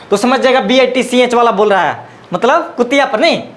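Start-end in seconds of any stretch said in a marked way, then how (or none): none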